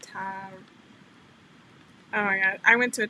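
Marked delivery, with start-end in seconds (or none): 0.0-0.5 soft, low "Tara" broken off as TAH; failed pronunciation attempt. Shown as a demonstration. Trying a viewer's name and failing, attempted pronunciation. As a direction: try "Tara" with a drawn open AH. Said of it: "it's like, I can't do it".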